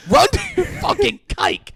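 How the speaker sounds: very high, loud shouted slur; hammed-up furious yell; mock screaming yell. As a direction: scream it after her as she runs. Voicing Derek's slur.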